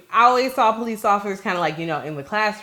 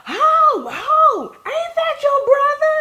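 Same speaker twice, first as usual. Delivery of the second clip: put-on high-pitched teasing girl's call, much higher; 6 dB louder, much slower than usual. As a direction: mimic a neighborhood girl calling out, pitched way up. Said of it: "She said".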